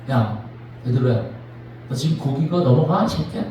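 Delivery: low, gruff mock scolding; a lightly voiced rough rebuke; hypothetical scolding Jesus, low and rough; mock harsh Jesus. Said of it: "죄송해요, 이렇게 해서".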